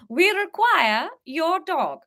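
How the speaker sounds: a grand sinister royal's request; menacing Queen repeats the demand; posh English Queen. Acted. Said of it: "as the Queen".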